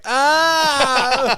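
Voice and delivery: put-on sudden high yelp of a kid falling; imitated kid's yelp. Retelling the brother's fall with a shrill yelp. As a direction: squeal like a little brother landing in Legos. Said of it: "we heard him just like".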